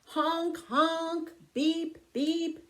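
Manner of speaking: high, playful car-horn sounds; reading car horns to kids, playful and high; horn honks. Acted. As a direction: toot it out like a toy horn. Horns as Pete drives up.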